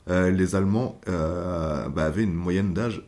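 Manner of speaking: normal talking voice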